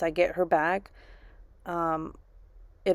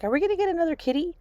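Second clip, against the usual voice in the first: put-on much higher, fast eager child voice; little son asking hopefully for a new kitty; hopeful little boy; 12 semitones higher, much faster than usual. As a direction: squeak it out like an eager kid. Mom voicing son asking for kitty.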